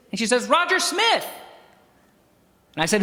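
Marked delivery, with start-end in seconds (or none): 0.5-1.3 imitating the lady. Lady names her brother.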